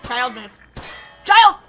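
loud exasperated yell; scolding shout. Real, not acted. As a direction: shout it as one short scolding burst. Scolding someone in the game.

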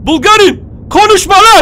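loud, high furious yell; loud high angry outburst; angry shout. Real, not acted. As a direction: silence him with a snarl. Shouting down the enemy.

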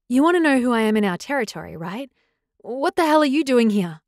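loud, rushed challenge; all at once. A narrator's reading. Girl challenging a stranger in pack territory, all at once. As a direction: fire the questions out in one go. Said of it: "She said it all at once".

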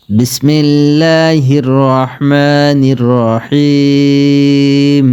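slow, drawn-out chanted Bismillah; Quran recitation. Shown as a demonstration. Teacher opening the recitation, slow chant. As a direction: intone it slowly like tilawat.

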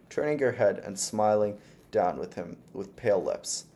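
ordinary delivery

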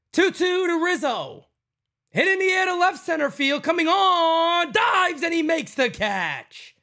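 high, loud rising call of a diving catch; excited play-by-play. Real, not acted. Streamer calling the catch for his viewers, excited play-by-play. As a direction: call it like the ball is still in the air.

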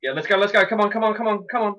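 high, loud rapid-fire repeats; hyped urging. Real, not acted. Player urging on the fight, loud hyped chanting.

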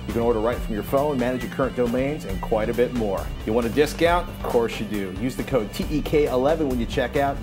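usual speaking voice

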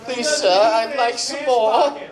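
high, slow begging orphan; mock pleading orphan voice; Oliver Twist plea. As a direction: beg like a hungry orphan. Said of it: "Fucking say".

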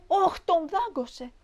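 high, drawn-out wail of alarm; alarmed grandmother's cry. A narrator's reading. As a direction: cry out slowly for the bitten child. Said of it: "Η γιαγιά φώναζε". (ψηλή, συρτή κραυγή γιαγιάς)

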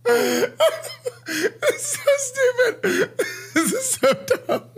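high, slow words through laughter; can barely get it out for laughing; laughing helplessly. Real, not acted. Laughing at something absurd.

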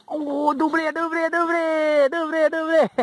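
real delight, repeated calls; excited cheer. Real, not acted. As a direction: chant it out over a double catch. Cheering a double catch.

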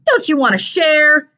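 high, slow bully singsong; mocking taunt. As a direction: tease him with a drawn-out question. Bully taunts with stolen backpack.

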